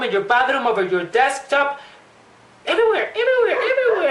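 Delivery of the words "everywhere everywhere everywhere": high, laughing despair; laughing exasperation. Acted. Vlogger mock-panicking over the lost nail clipper, laughing high. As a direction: laugh it out while searching frantically.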